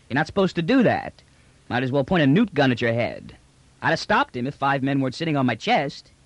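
indignant trader talking back to an officer; brash space trader, indignant. Acted. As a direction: protest like a roughneck trader. Trader protests to the general.

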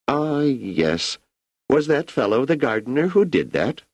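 put-on an ageing emperor musing easily to an old friend; Emperor Cleon.

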